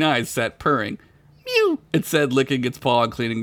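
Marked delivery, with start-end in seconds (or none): 1.5-1.8 high squeaky kitten mew. Acted. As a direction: mew like a tiny cat. Cat voice in story.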